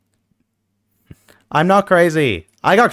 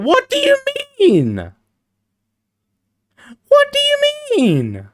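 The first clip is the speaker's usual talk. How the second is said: leprechaun voice. Pretend. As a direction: squeak it like a tiny leprechaun. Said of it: "the leprechaun voice".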